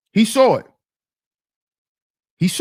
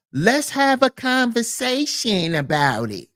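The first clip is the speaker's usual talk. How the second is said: slow, drawn-out, softer mock plea; mock reasonableness; 2 semitones higher, 6 dB quieter than usual. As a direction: stretch it out as a mocking call for discussion. Mimicking lenient critics' double standard.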